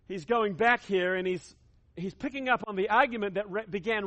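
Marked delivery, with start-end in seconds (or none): none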